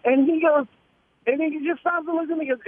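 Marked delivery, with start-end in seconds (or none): none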